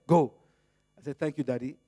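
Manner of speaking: normal talking voice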